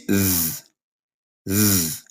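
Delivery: voiced th buzzed out low; exaggerated th practice. Shown as a demonstration. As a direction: buzz the th with the throat vibrating. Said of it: "the voice T H".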